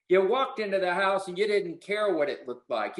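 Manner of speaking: ordinary delivery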